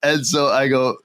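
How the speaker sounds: normal talking voice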